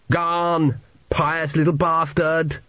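high, drawn-out jeer; annoyed heckle. A narrator's reading. Pillow thrown at praying boy.

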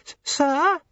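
put-on high, timid servant girl; nervous young maid. A narrator's reading. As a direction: stammer it out, frightened.

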